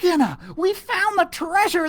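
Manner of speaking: ordinary delivery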